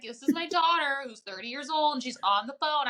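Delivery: lightly put-on embarrassing-mother voice; mock mom introducing daughter. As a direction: present your grown child to the receptionist. Voicing mom introducing adult daughter.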